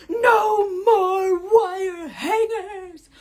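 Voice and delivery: Mommy Dearest meltdown, high and loud; hammed-up wail, every word stretched very slowly; freaking out. Imitating Mommy Dearest raging over hangers, freaking out. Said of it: "she's, like, freaking out".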